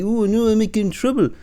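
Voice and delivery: high, put-on panicky squeak; sneering high mock voice; mocking whiny voice. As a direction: mimic a fretful coward, way up high. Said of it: "No, it's like".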